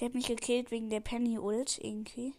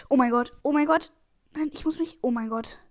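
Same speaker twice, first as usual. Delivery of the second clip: loud panicked outburst; 6 dB louder than usual. Real, not acted. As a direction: near miss, blurt it out loud. Narrow escape in game.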